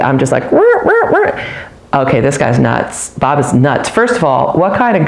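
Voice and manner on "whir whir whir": high mock horror-film whirring noise; imitating creepy horror soundtrack; horror movie whir. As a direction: squeal a spooky whir like a scary movie. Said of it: "like a horror movie".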